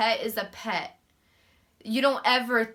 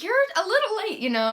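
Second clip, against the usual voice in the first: mock vet staff voice, much higher; vet receptionist; 10 semitones higher, faster than usual. Imitating vet staff scolding her for being late, much higher. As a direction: chirp it like a snippy front desk. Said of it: "They were like".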